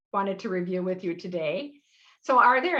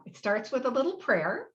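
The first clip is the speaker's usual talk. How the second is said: usual speaking voice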